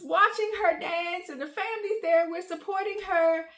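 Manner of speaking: high, wailing retold meltdown; boo-hooing. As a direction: re-enact tearful venting, starting loud and easing a little. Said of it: "I just started boo-hooing".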